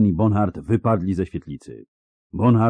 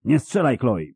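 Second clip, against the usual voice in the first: high warning cry; raised, urgent voice; urgent call; 5 semitones higher than usual. A narrator's reading. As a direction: call out sharply to stop the shooter. Calling out to stop a shot.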